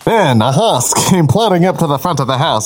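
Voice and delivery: usual speaking voice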